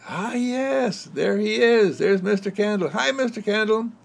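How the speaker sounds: put-on children waving hello to a candle; excited children.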